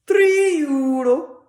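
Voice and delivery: 高く引き伸ばした大声のギリシャ人店員; loud, high, drawn-out heavily accented quote; Greek sandwich man. Retold or imitated.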